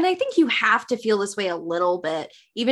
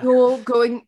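put-on British accent; 6 dB louder than usual. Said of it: "my British accent is crap now".

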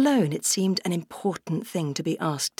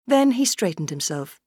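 plain reading voice

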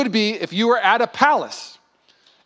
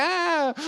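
laughing ah; 10 semitones higher, 5 dB quieter than usual. Retold or imitated. Childhood joy recalled.

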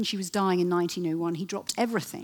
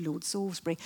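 usual speaking voice

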